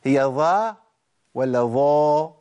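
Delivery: ظا drawn out slowly and weak; wrong demo of a faded ظا. Shown as a demonstration. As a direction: stretch ظا out with a pale, weak sound. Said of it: "الصوت عندي باهت".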